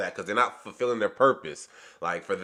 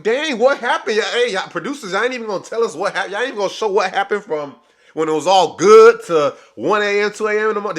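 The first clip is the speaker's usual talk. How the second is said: higher, louder disbelieving call to the producers; baffled outburst; 7 semitones higher, 6 dB louder than usual. Retold or imitated. Vlogger calling out the show's producers in loud disbelief. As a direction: throw up your hands at the editors. Viewer reacting to cut scene.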